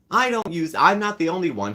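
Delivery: high, loud defensive mock; mock defensive whine. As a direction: sneer her excuse back high. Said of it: "she'll defend it".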